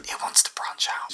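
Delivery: whispering. Real, not acted. Aside to partner.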